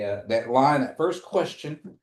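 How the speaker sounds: usual speaking voice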